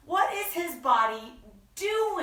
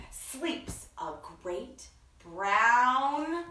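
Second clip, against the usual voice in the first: hushed and lilting, with drawn-out words; picture-book story reading; 2 semitones higher, slower than usual. A narrator's reading. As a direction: read to small children, savouring the rhyme. Reads picture book aloud.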